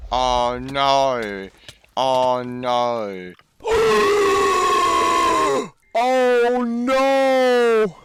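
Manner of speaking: real alarm at the game; gasp and cry. Real, not acted. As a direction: shriek in real panic. Gameplay disaster, cries out.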